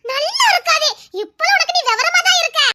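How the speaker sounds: high-pitched teasing mimicry; mocking echo. Friend echoing the other's objection, mocking.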